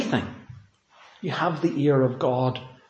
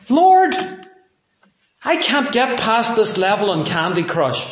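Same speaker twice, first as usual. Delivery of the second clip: loud, much higher cry of Lord, then a mock-trivial complaint; bold prayer call; 6 semitones higher, 5 dB louder than usual. Preacher's playful example of a believer praying, bold loud call. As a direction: call out boldly, half joking. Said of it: "come boldly and say".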